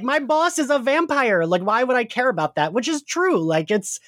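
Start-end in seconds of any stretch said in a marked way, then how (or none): 0.0-2.7 imitated dismissive retort. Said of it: "And she's like".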